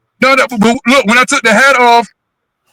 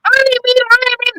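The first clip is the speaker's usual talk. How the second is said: imitating Flo. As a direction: gush sweetly like an excited friend. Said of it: "I said".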